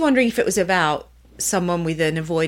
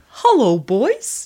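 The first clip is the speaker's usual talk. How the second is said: Terry the Turkey impression; 3 semitones higher, much slower than usual. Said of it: "Do you remember that?".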